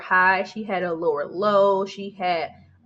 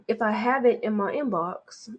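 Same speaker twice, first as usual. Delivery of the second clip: a self-confessed bad British accent, lightly done; mock British accent. Shown as a demonstration. Checking her inbox for a viewer's link, in a mock British accent. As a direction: playfully slip into a clumsy British accent. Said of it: "I'm horrible with accents, but I just feel British right now".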